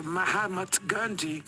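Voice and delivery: ordinary delivery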